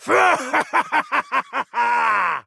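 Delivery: over-the-top evil laugh; evil cackle. Acted. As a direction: cackle like a triumphant dark lord. Villain laughs after boast.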